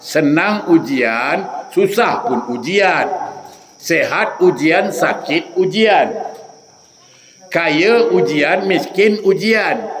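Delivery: deliberate, slow measured list; slow preaching list. Acted. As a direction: lay each pair down slowly. Khutbah berirama tentang ujian. (senarai perlahan berirama)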